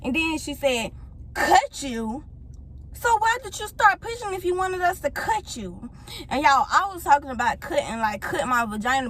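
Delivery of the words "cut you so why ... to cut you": high, incredulous mocking question; imitating rude nurse. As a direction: mimic a scornful nurse questioning a patient. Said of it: "Like, she was just so rude".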